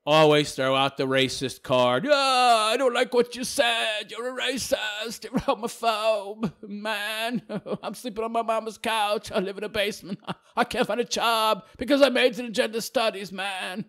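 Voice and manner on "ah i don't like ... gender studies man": mock whining leftist. Host mocking an unemployed gender studies graduate, whiny. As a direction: whimper it like a sulky slacker. Mocks a leftist troll's complaining.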